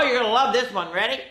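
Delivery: ordinary delivery, nothing marked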